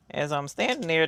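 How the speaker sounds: normal talking voice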